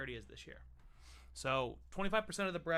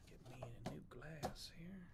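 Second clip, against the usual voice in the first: ordinary talk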